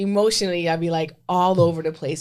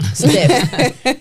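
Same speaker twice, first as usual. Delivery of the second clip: exaggerated stiff; 4 semitones higher, 9 dB louder than usual. Describing stiff body.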